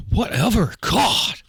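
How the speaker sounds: put-on loud, high teen scoff; exasperated teenager. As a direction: brush off the adult with disgust. Teenager snaps back at adult.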